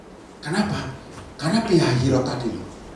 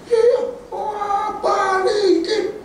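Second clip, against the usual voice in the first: very high, slow whining; whiny demand; much slower than usual. Acted. The crowd begging to go back, very high and whiny. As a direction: whine to be taken back. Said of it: "mereka rame-rame".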